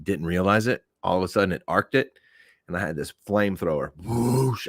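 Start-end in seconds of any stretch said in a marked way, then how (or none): none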